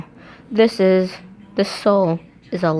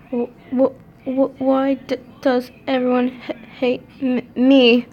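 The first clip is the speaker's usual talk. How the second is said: stuttering sob; 6 semitones higher than usual. A narrator's reading. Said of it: "say it slow at first".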